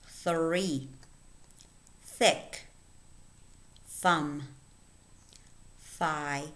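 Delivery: isolated words, carefully articulated with long pauses; listen-and-repeat word reading. Shown as a demonstration. Teacher reading a word list for learners, careful and separated. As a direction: read each word as a model to copy. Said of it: "Listen and repeat".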